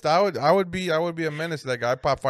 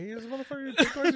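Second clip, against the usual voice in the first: higher, strange put-on voice, puzzled; mock puzzled question; 11 semitones higher than usual. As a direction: ask it like a baffled neighbor, pitched up. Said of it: "I'm like".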